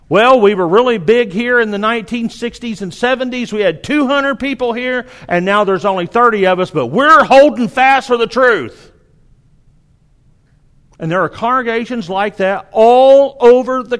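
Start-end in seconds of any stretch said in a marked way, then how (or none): 0.1-8.9 proud, higher and louder, hammed-up nostalgia; lightly voiced churchgoer clinging to the glory days; mock old member. Mocking a shrinking congregation.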